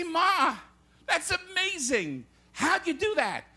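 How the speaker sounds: high-pitched astonished son; amazed. Acted. Son to his mother after her correct guess, amazed. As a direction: marvel at it, pitched well up.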